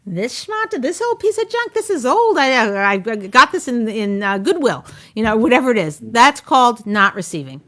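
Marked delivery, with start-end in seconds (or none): 0.0-4.8 dismissive wife. Retold or imitated. Wife waving off her husband's compliment, mock scoffing. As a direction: brush off the compliment as if it's junk. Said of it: "And you're like".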